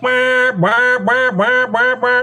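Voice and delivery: high drawn duck call imitation; long ringing call notes up high; imitated ringing call notes. Shown as a demonstration. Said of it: "it's not gonna have that".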